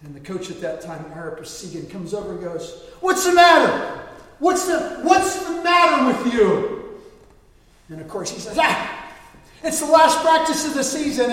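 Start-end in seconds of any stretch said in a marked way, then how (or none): 3.0-7.0 high, loud angry coach yell; furious coach yelling at a player; coach shouting. Retold or imitated. 8.6-11.3 high, loud defensive yell; angry player shout. Retold or imitated. Player shouts back at coach.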